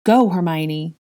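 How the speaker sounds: urgent shouted order. A narrator's reading. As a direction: order her away. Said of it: "Draco ordered".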